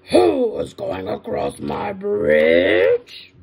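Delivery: slow, loud, stretched-out bellow; troll's shout. Acted. Troll challenges the big goat, slow shout. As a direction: roar it long and slow. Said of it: "jumped up from under the bridge and shouted".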